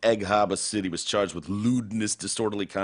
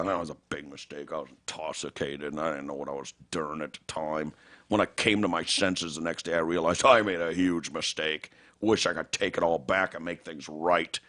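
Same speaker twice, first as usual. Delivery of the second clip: lower, softer sheepish statement; mock contrite apology; 5 semitones lower, 3 dB quieter than usual. The son reading his public apology, lower and softer, mock sheepish. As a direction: read the apology like a guilty kid.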